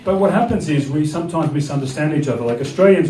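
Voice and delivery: ordinary delivery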